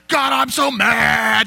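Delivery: slow, drawn-out mock shout at God; mad lament. Shown as a demonstration. Musician acting the angry lament psalm, slow mock shout. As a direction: cry out in staged anger, stretching each word. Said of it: "like this".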